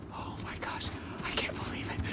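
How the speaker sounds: breathless whispered disbelief; awed whisper. Real, not acted. Player overwhelmed by the view to viewers, awed whisper. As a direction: hush it out, stunned.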